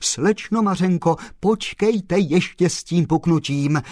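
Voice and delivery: high, loud appeal; exclaimed. A narrator's reading. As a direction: call out to stop her. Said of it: "zvolal pan Kolbaba".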